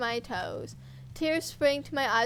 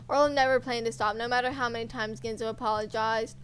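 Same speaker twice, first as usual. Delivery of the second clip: ordinary delivery, nothing marked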